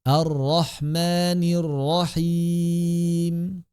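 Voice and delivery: slow chanted tilawah; Quran recitation. Shown as a demonstration. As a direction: recite slowly with melody. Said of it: "recitation demo". (tilawah pelan)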